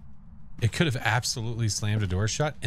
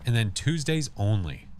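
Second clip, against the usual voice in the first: usual speaking voice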